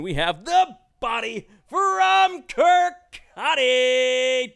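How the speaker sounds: big, high ring announcer intro. Acted. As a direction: boom it out like a wrestling announcer. Podcast host announcing guest.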